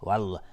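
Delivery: light l, spread vowel in والله; wrongly thin Allah. Shown as a demonstration. Teacher repeating the mistake, thin والله. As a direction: say والله with an open mouth, no heaviness.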